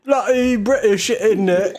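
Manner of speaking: overdone British voice teasing a friend; British voice mocking the friend's accent. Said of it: "what, Harry Potter?".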